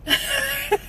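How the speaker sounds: brief high self-deprecating laugh. Real, not acted. Speaker admitting she's not Wonder Woman, soft high laugh.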